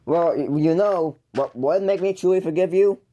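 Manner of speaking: ordinary talk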